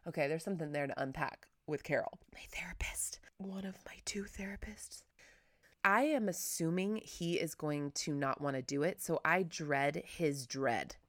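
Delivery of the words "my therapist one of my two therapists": whispered aside. Real, not acted. Aside about therapists.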